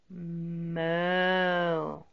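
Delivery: cow moo. Shown as a demonstration. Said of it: "what does the cow say?".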